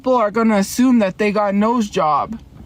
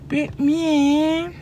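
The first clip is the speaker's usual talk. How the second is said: high, stretched cartoon kid voice; Eric Cartman; 6 semitones higher than usual. Streamer repeating what Cartman always says, high cartoon impression. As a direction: pitch it up and draw out the ending. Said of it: "He's always like".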